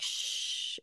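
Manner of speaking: whispered shush. Retold or imitated. Girl hushing a crying kid.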